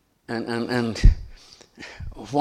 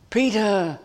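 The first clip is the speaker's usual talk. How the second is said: higher, louder call of the name; 9 semitones higher, 4 dB louder than usual. Acted. Jesus calling to Peter, loud and high.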